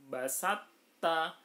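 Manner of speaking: "Basat ta" recited loud with a held doubled t; "Basat ta" as tajwid, t held then opened; merged to into ta. Shown as a demonstration. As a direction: chant "Basat ta" with no separate heavy t released. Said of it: "toknya dihilangkan, taknya ditasdidkan".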